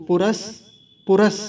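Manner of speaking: पुरस cut off with a held stop on स; pause after पुरस. Shown as a demonstration. Teacher showing learners where to pause, stopping after पुरस. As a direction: say पुरस, then stop briefly. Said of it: "पुरस पे रुकेंगे थोड़ा सा".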